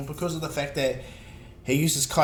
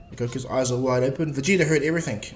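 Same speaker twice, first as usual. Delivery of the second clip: ordinary delivery, nothing marked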